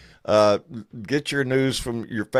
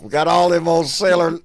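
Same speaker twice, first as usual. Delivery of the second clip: put-on gruff old fisherman; 6 semitones higher, 7 dB louder than usual. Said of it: "That's Navy talk".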